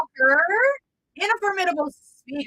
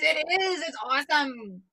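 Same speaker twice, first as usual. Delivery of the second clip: normal talking voice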